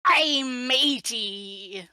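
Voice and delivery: slow, drawn-out hammed-up pirate. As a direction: stretch it out like an old pirate. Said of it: "what did the pirate say".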